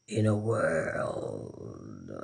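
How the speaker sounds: very low, very slow trailer voice; voiceover voice. Acted. Streamer showing she could do voiceovers, deep trailer voice. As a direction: drag it out deep and soft like a film trailer. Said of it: "I could do voiceovers".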